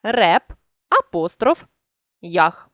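Реп, then ях, with a pause between the parts of Реп'ях; dictating a word break; split into syllables. Shown as a demonstration. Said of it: "Апостроф і м'який знак при переносі".

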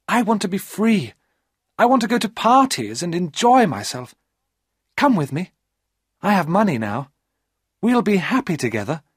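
put-on young woman begging him to come; eager high female voice; Maria's pleading voice.

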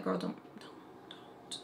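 hushed, breath-only caution; whispered warning. Retold or imitated. Makeup artist warns girl about her lipstick, whispered. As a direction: whisper it under your breath as a retold warning.

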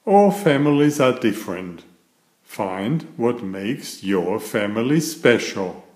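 cheerful bedtime story reading; reading to children. A narrator's reading. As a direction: read it like a picture book to little kids. Reads picture book to children.

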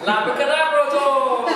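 slow, grand magic incantation; magician's magic word. Acted. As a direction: proclaim the spell with flair.

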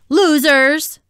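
high, loud taunt; loud shout. A narrator's reading. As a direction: shout it after the fleeing bullies. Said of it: "Henry shouted really loudly".

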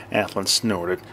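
ordinary delivery, nothing marked

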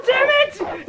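ordinary talk

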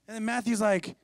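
ordinary talk, nothing marked

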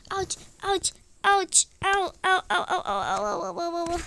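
playful run of high ouches; hammed-up ouching. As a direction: whimper ouch over and over. Character hurt after tripping.

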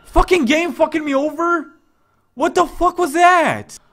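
high, loud raging yell; furious at the game; angry outburst. Real, not acted.

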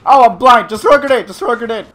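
high, loud alarmed yelling; alarmed shout. Real, not acted. Blinded in game.

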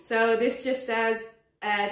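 ordinary talk

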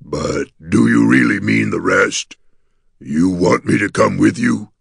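slow, disbelieving creature question; astonished gargoyle. A narrator's reading. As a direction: ask in the monster's voice, hardly believing it. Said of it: "he gasped".

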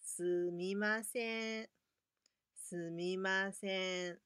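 slow, careful すみません; teacher modelling the phrase; slow model sumimasen. Shown as a demonstration. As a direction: sound out すみません for beginners. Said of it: "就会对他说".

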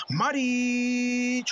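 long stretched goal shout; breakneck goal commentary. Acted. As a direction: hold the name like a goal call. Goal call, held shout.

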